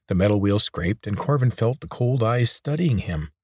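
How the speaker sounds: ordinary delivery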